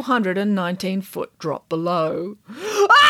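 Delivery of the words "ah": loud high falling yelp; startled yelp. Acted. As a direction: cry out at the drop. Armadillo falls.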